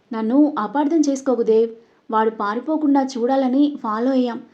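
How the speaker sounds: ordinary delivery, nothing marked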